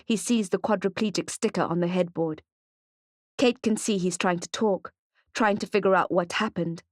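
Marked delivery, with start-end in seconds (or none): none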